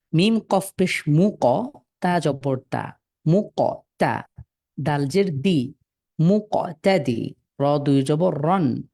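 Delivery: slow, deliberate blending; slow spelling demo. Shown as a demonstration. Teacher modelling how to spell and join the letters. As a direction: spell মিম কফ পেশ মু ক slowly, joining each part. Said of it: "একটা একটা করে পড়বেন এবং পূর্বেরটার সাথে মিলিয়ে পড়বেন".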